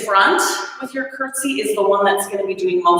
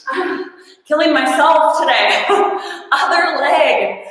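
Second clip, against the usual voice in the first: laughing; 6 semitones higher than usual. Real, not acted. Laughing at own stalling.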